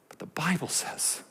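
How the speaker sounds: sudden breathy whisper, no voice. Real, not acted. Pastor suddenly hushing his congregation before the verse. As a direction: drop to a hush.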